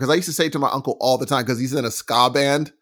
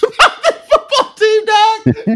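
high, loud, laughing disbelief; laughing amazement; 6 dB louder, slower than usual. Real, not acted. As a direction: crack up laughing while exclaiming over fanatical fans. Cracking up at football fanaticism.

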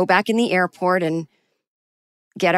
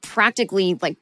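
ordinary delivery, nothing marked

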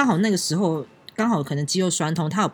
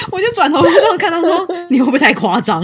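laughing; 11 semitones higher, 8 dB louder than usual. Real, not acted. Laughing retelling.

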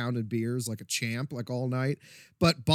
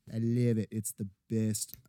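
usual speaking voice

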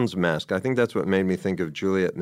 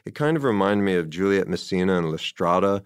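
ordinary talk

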